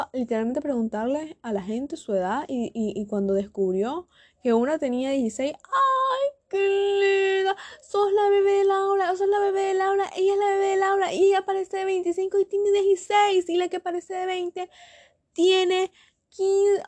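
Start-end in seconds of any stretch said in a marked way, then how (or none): 5.5-16.8 high, sustained mock cooing voice; gushing classmate. Classmate calling girl the baby, mock gushing. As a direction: imitate someone fawning over the baby of the class.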